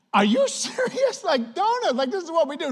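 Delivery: high, laughing disbelief, slowing on serious; incredulous. Retold or imitated. Coach told donuts banned, laughing high incredulity. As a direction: squeak out shocked disbelief at the ban.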